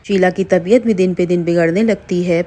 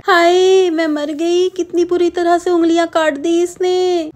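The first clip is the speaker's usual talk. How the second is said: pained wail; 10 semitones higher than usual. Acted. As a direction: wail as if fingers were cut. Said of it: "बुरी तरह चिल्लाने लगती है".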